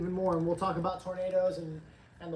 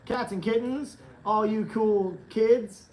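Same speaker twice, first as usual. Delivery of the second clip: loud, slow, stretched-out show opener, louder; hyped greeting; 3 semitones higher, 10 dB louder, slower than usual. Acted. Host greeting the live audience, loud drawn-out hype welcome. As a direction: belt out the welcome like a radio host.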